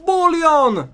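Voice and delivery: high, loud cry of the name; character cries out a friend's name, loud; shout. Acted. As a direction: yell the name out in alarm. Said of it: "Wykrzykują Tambo i Orzo".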